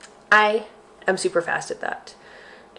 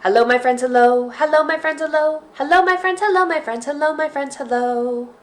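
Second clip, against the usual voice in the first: quicker singsong hello, climbing high; faster hello song; 7 semitones higher, 3 dB louder than usual. Shown as a demonstration. Teacher speeds up the hello chant for kids. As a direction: pick up the pace on the greeting. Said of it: "just a little bit faster this time".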